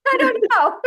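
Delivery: laughing real hard while trying to speak. Real, not acted. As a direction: giggle it out helplessly. Laughs through the words.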